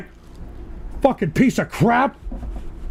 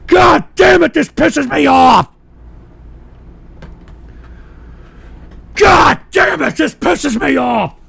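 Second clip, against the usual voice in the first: furious cursing. Real, not acted. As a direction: burst out, then sag in frustration. Repeated cursing at the mess.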